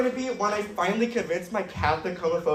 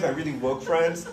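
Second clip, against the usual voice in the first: normal talking voice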